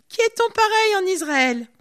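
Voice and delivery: loud, high mocking challenge; ironie criée. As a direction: taunt him loudly from afar. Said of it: "beaucoup d'ironie dans ce qu'il dit". (provocation ironique, forte et aiguë)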